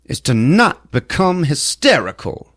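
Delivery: high, emphatic lawman address; put-on official voice, punching key words; Sheriff Heidegger. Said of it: "then we got Sheriff Heidegger".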